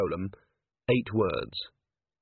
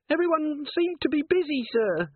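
very high, strained little voice; frightened dwarf corporal. A narrator's reading. Littlebottom dangles from the candle line, squeaking anxiously. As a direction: squeak it out while straining to hold on.

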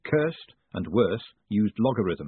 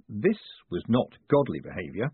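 usual speaking voice

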